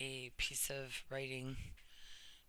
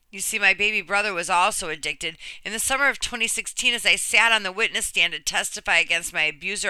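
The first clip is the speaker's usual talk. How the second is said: earnest, measured reading of a personal essay; reading a story aloud; 4 semitones higher, 12 dB louder than usual. Acted. Reading a friend's brave story to viewers, earnest narration. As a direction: read it like you're giving her story a voice.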